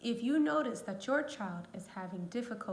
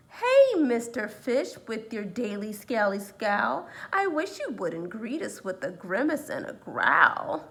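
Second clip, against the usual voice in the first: jellyfish complaining; 9 dB louder than usual. Acted. The jellyfish asks the fish to stop growling, pleading.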